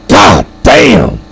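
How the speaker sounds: hoarse-edged retold shout; imitating a friend's loud, stunned yell. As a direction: yell it out like the friend did, drawing out each word. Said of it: "John Garobi yelled out loud".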